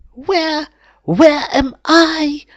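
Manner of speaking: very high, small, bewildered squeak; the mouse waking on the sofa, confused; weak little mouse. A narrator's reading. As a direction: wake up lost and confused like a tiny mouse. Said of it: "asks the strange little mouse".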